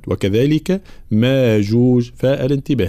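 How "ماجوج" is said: "ماجوج" with a long "maa"; madd without hamza. Shown as a demonstration. Teacher showing Qalun's reading, "ماجوج" with long a. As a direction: slowly stretch "ما" in "ماجوج". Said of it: "يبدل الهمزة هنا حرف مد".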